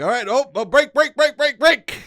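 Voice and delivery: panicked high shouts of brake; frantic dad shouting to stop the car; dad yelling brake. Retold or imitated. Dad in the parking lot, shouting panicked at new driver. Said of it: "he's like".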